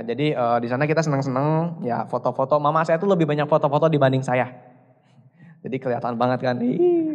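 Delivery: ordinary talk, nothing marked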